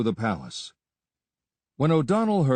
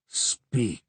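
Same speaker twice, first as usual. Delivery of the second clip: dangerous. A narrator's reading. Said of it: "dangerous as the hiss of a cobra".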